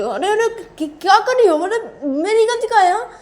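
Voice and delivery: very high, loud squawked objection; acted flustered protest. Character being force-fed by partner protests, flustered and loud. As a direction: protest loudly, pitched far above normal.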